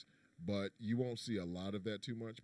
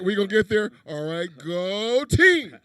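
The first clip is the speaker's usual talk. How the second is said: loud, slow, drawn-out cheer; rally the team, loud and slow; hyped cheer; 8 semitones higher, 16 dB louder, slower than usual. Acted. Hyping the swingers party.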